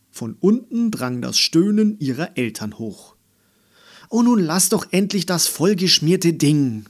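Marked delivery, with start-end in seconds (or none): 4.1-6.9 loud, high-pitched grousing; nagging. A narrator's reading. Mama about the scribbled old backpack, nagging. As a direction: nag in exasperation. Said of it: "meckerte Mama".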